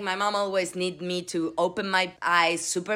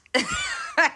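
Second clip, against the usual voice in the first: amused laugh. Real, not acted. Laugh in mock reply.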